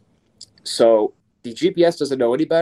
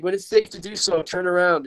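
friendly cop; 8 semitones higher than usual. Retold or imitated. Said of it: "He's like".

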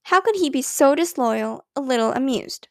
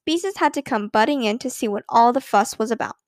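usual speaking voice

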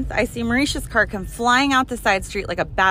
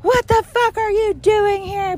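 drawn-out, very high imitated screech; mock scream; 13 semitones higher, 3 dB louder, slower than usual. Imitating roommate screaming outside the house, mock scream. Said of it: "She starts screaming bloody murder".

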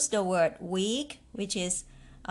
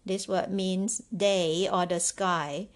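normal talking voice

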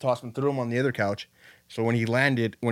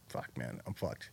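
low, much softer resigned dread; mock dread; 11 semitones lower, 15 dB quieter, slower than usual. Facing someone who pulls a gun, low soft resigned dread. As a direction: sink into a hopeless murmur. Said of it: "it's like".

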